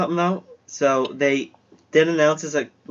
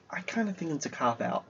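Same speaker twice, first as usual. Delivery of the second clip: whisper; 12 dB quieter than usual. Real, not acted. Side comment under breath.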